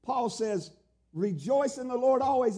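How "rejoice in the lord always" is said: higher-pitched emphatic proclamation. Retold or imitated. Preacher quoting Paul's command to the church, emphatic. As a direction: proclaim it with full conviction. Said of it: "he's so emphatic".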